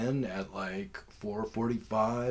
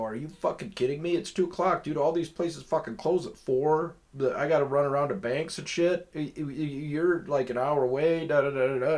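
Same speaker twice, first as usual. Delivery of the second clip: higher and louder, exasperated; retold angry rant; 6 semitones higher, 6 dB louder than usual. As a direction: blast him for being late, mock outrage. Retold angry reply to late seller.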